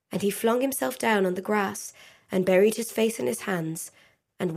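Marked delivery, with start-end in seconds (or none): none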